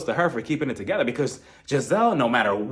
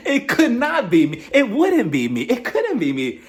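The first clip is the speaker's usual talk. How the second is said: loud, slow, laughing through it; laughing disbelief; 9 semitones higher, 4 dB louder than usual. Real, not acted. Reacting to Kenya's show, laughing loudly. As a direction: laugh out your refusal.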